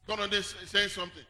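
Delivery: ordinary talk, nothing marked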